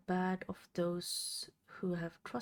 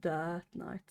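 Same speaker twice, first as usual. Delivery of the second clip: mock robot voice, flat and mechanical. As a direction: imitate a deadpan robot. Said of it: "I sounded like a robot when I said".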